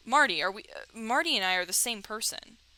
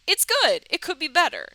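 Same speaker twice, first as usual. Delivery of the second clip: intended gentler line; 6 semitones higher, 5 dB louder than usual. Shown as a demonstration. Player demonstrating the kinder answer he wanted. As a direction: kindly nudge him. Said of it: "I wanted him to be like".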